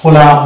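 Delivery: কোলাম said with a final m and no b; model pronunciation. Shown as a demonstration. Teacher showing how the word is said, clearly. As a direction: give কোলাম with the b left silent. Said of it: "এটা হচ্ছে".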